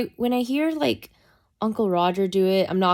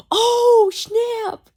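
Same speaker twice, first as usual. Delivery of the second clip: very high, loud mock shock; shocked realisation; 6 dB louder than usual. Retelling reading the comments about the drug, high-pitched shock. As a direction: squeal it as the joke finally lands. Retold shock at learning the meaning.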